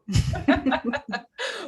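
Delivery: high, loud amused laugh. Real, not acted. Laughs about speaking Flemish.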